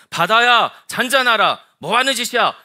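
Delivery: high, loud commanding yell; acted scolding shout; shouting at the sea. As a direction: bellow a rebuke out over the waves. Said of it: "이렇게 소리치고 있다고".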